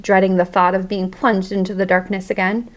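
usual speaking voice

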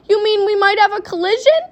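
high, loud, incredulous question; alarmed cry. A narrator's reading. Father asks Wonka about crashing, alarmed cry. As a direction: cry it out, aghast. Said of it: "cried Mister T V".

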